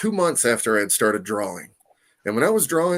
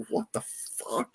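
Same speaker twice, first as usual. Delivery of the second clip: slow, hushed, high-pitched disbelief; stunned aside; 7 semitones higher, 11 dB quieter, slower than usual. Retold or imitated. Artist in line seeing a man crying, stunned aside. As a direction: draw it out softly, as a stunned aside.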